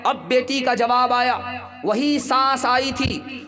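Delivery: mother pressing her daughter, rising to a preacher's climax; preaching at full force. Acted. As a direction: drive the point home to the crowd.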